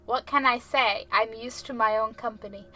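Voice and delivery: usual speaking voice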